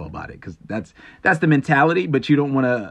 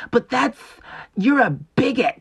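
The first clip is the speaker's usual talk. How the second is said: high, loud, slow shocked whine; mock outraged critic; 11 semitones higher, 4 dB louder, slower than usual. Mocking a critic shocked by his views, high outraged whine. Mocks critic accusing him.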